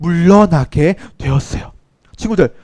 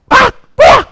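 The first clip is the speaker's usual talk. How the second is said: high yelp of pain and shock; sudden high ouch, then what; pained startled cry; 4 dB louder than usual. Retold or imitated. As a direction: cry out as the bite hits. Bitten by a snake.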